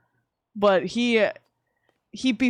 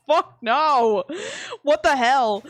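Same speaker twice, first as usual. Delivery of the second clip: louder, raised mock outrage; re-enacted indignant outburst; outraged refusal; 5 semitones higher, 4 dB louder, slower than usual. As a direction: blurt out an appalled refusal. Outraged reply to snake feeder.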